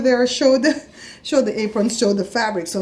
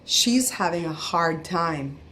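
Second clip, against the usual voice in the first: slow, measured retold remark; cashier's remark imitated; 3 semitones lower, 4 dB quieter, much slower than usual. Cashier to her boss about the customer, slow and measured. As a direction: say it slowly, spacing out each word. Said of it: "she looked at her boss and said".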